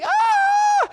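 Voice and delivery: very high mock shriek in a strange voice; mock scream. Man fighting a spider, shrieking very high mock scream. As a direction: scream like you're fleeing for your life.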